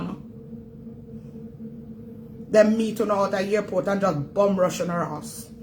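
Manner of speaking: usual speaking voice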